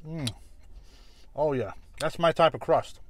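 chewing between words; muffled by food; mouth full of pizza. Real, not acted. Seen 0: Bit the slice at -4; cheeks puffed and chewing through the words.

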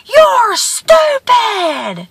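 slow, high holler; drawn-out high yell; screamed insult. A narrator's reading. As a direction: hurl the insult across the valley. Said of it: "he screams again".

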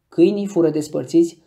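plain reading voice

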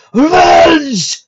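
high, drawn-out scream. A narrator's reading. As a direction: scream it out like an attacking phantom. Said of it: "suddenly took down a scream".

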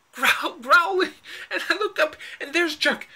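laughing through the words. Acted. Laughing through the absurd story.

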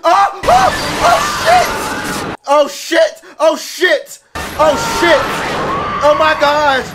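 high, loud repeated cries of horror; panicked shouting. Real, not acted. Viewer watching the plane burn, panicked shouting. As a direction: shriek out each exclamation in panic.

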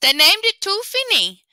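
high, loud, gleeful reveal; glinting rabbit. Acted. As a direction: deliver the punchline with a wink. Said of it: "with a glint in his eye".

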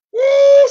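high whooping shout of joy; sudden praise whoop; joyful woo. Real, not acted. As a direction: let out a soaring woo. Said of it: "I'm gonna shout right now".

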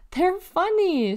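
put-on high, slow, amused child voice; giggled. A narrator's reading. Delly amused by the chickens, giggled. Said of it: "she giggled".

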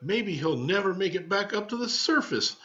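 put-on high, hushed-up doom from a kid; dramatic little girl. Elaine predicts Marvin won't resurface, high and dramatic. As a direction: deliver the doom with relish. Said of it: "She paused dramatically".

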